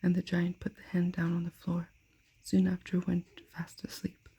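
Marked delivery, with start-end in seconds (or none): none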